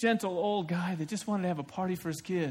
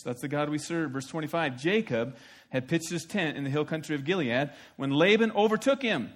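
normal talking voice

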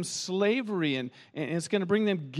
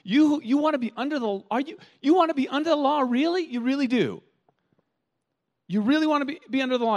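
incredulous; 8 semitones higher than usual. Retold or imitated. Pastor voicing Paul rebuking the Galatians, exasperated and high. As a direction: throw up your hands in disbelief. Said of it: "It's like Paul is saying".

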